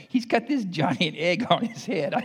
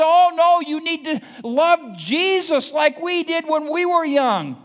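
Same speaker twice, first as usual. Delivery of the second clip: mock panicking parent; 11 semitones higher, 7 dB louder than usual.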